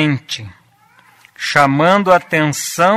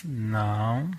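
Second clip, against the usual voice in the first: a low, soft but firm no; 6 semitones lower, 12 dB quieter than usual. Shown as a demonstration. Parent refusing a child, low and firm. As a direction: say no calmly with no shouting. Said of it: "mas você falou firme".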